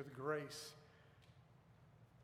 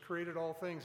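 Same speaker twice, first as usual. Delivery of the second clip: ordinary talk, nothing marked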